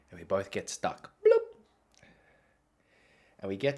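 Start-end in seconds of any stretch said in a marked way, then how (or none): 1.2-2.1 sudden high, loud bloop; imitated bloop. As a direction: pop out a cartoonish bloop. Imitates getting stuck in the tube.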